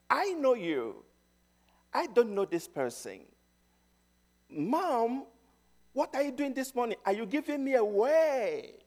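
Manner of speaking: put-on a small child begging not to be left; frightened child.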